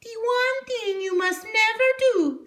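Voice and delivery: put-on high old witch voice; Strega Nona voice. A narrator's reading. Strega Nona instructs her new helper, high old witch voice. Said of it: "said Strega Nona".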